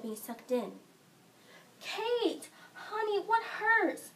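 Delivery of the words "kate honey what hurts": high, drawn-out anxious question; acted mother fretting over her child; worried mother. Mother to crying daughter clutching her stomach, worried.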